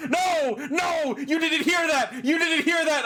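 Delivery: high, urgent denial; squeaky flustered cover-up; mock panic. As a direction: frantically take it back, hammed-up.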